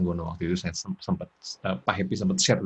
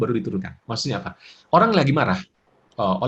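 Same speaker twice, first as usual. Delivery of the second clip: ordinary talk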